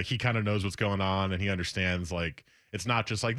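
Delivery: ordinary talk, nothing marked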